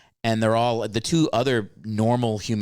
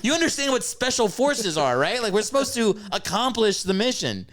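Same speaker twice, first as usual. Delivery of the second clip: mock scolding instructor; 11 semitones higher than usual.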